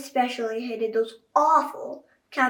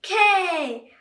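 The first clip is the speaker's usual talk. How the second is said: higher, louder "K"; crowd shout; 6 semitones higher, 8 dB louder than usual. A narrator's reading. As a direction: yell back like fans. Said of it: "repeated the fans".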